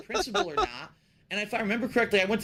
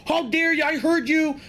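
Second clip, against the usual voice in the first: higher, mildly louder scolding teacher; angry teacher; 7 semitones higher, 3 dB louder than usual. Retold or imitated. Teacher scolds innocent pupil, higher, mock angry. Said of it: "ripped me a new asshole".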